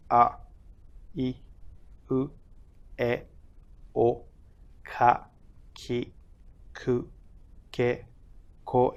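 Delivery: A, I, U recited as "ah, ee, oo", each apart; Japanese syllable sounds. Shown as a demonstration. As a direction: recite Japanese kana sounds one by one, short and even. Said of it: "which go like this".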